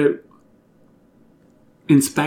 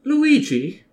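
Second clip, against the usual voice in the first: surprised; 14 semitones higher, 3 dB louder than usual. Acted. Queen surprised.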